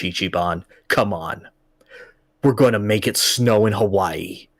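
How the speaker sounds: low, earnest mock-heroic voice; solemn baby-man boss. Baby-costumed boss rallies the hero to make snow, low and solemn. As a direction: drop the pitch for a manly rallying speech.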